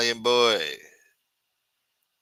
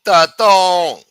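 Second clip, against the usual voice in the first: high, loud shout-out; name call-out; 8 semitones higher, 15 dB louder than usual. Real, not acted. Host greeting viewer Taton, loud. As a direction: boom the name.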